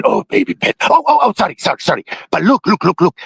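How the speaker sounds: normal talking voice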